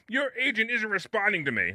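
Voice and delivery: high, complaining imitation of a pushy realtor; whiny realtor voice. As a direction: realtor yelling at the broker, high mocking voice. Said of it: "Can you do the rest of the show with that voice".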